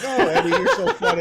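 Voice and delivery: very high, loud mock nurse, laughing; tickled nurses. Imitating nurses delighted by a patient's joke, hammed-up high laughing gush. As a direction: squeal it like a charmed nurse, through laughter. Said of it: "all the nurses at the".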